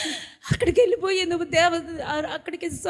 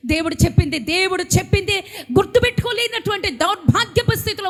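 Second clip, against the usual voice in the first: rising to a loud, higher preaching cry; impassioned pulpit shout; fervent rebuke; 5 semitones higher, 4 dB louder than usual. Real, not acted. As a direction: hammer it home at the flock.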